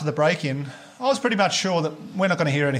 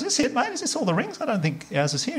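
much higher, strained worried questions; anxious questioning; 10 semitones higher than usual. Retold or imitated. Comedian asking the clerk about the rings, high and strained. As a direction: ask like your hopes are sinking. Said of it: "I say to the guys".

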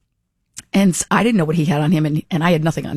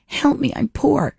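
higher, hammed-up whimper of a plea; mock pitiful plea; 8 semitones higher, slower than usual. Poor lady begging on the airplane, higher mock plea. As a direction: play the poor lady being kicked out of first class. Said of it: "Like the lady on the airplane in Bridesmaids".

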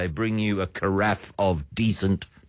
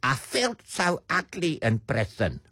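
high, put-on South African socialite accent; affected South African accent; 5 semitones higher than usual. Quoting a released prisoner, affected South African accent. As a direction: mimic her in an exaggerated South African accent. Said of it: "she is quoted as saying".

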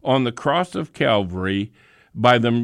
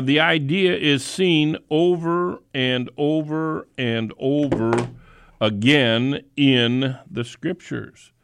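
normal talking voice

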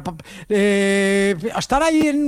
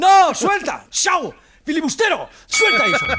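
high, sudden scolding yell; acted shout of alarm; 8 semitones higher, 3 dB louder, slower than usual. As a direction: yell to stop a misbehaving pet. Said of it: "Entonces yo le grito".

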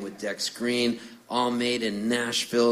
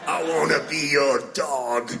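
light [name] snarl, a bit higher and louder; [name] impression; 4 semitones higher, 4 dB louder than usual. Bloodied from wiffle ball, doing his [name]. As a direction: croon it like a rock singer mid-slide. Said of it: "I looked like [name]".

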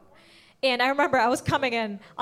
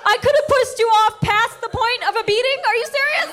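loud, high-pitched outraged disbelief; so mad; 12 semitones higher, 5 dB louder than usual. Acted. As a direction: explode in indignant mock outrage. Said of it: "I was so mad".

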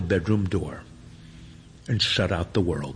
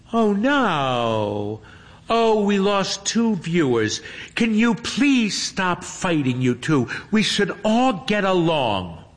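put-on high, dismayed girl pleading; pleading streamer voice; 9 semitones higher, 3 dB louder, slower than usual. A narrator's reading. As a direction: beg them to stop fighting. Hermione dismayed, losing viewers.

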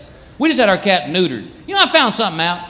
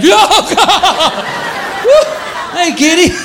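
half-laughing mock shout; startled yell; 10 semitones higher, much slower than usual. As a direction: jump back with a yelp.

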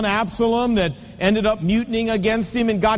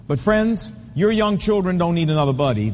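ordinary talk, nothing marked

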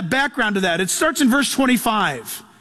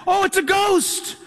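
higher and slow, a touch of dread; mock fear of dying; 6 semitones higher, slower than usual. Preacher voicing the frightened disciples in the boat, mild dread. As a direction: say it as if death is coming. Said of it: "their Hebrew mindset and the tradition of the day was".

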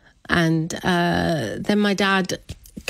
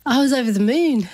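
laughing with joy; 7 semitones higher, 4 dB louder than usual. Real, not acted. Recalling joy at dad's reaction.

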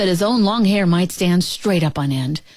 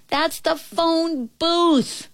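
high, slow distant holler; mock shouting; 10 semitones higher, slower than usual. A narrator's reading. Girl correcting the Nobble from afar, high slow mock shout. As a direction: spell it out slowly in a high call. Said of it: "the girl's voice shouted".

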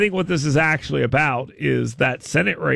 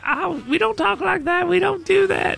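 put-on much higher, prim old-lady voice; little old lady; 13 semitones higher than usual. Imitating the old teacher scolding the kid, high prim old-lady voice. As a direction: mimic a sweet old teacher scolding gently. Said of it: "the little old lady's like".

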